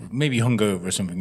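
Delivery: normal talking voice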